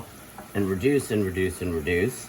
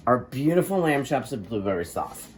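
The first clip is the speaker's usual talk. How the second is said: normal talking voice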